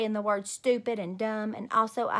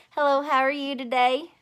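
mock sweet; 5 semitones higher, 6 dB louder than usual. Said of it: "I smiled very pleasant".

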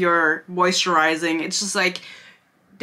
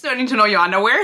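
rushed, louder rhetorical repeat; fast exasperated repeat; 4 dB louder, much faster than usual. Real, not acted. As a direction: rattle it off quickly in annoyance. Repeated reaction, rattled off.

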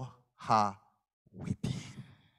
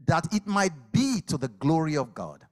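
proclaiming; 5 semitones higher, 5 dB louder than usual. Acted. Jesus proclaims, voice raised.

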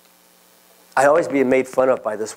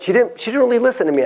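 much higher, exasperated; 10 semitones higher, faster than usual. Real, not acted. Teller complaining about busy cashier, pitched high with exasperation. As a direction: rise up high in frustration.

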